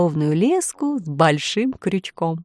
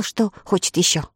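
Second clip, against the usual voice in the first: plain reading voice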